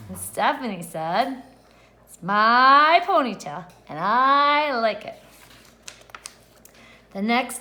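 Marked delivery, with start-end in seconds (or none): 2.1-5.2 loud, slow and proud; loud defiant retort. Acted. Stephanie answering the kids, loud slow defiance. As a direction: plant your feet and say it slowly.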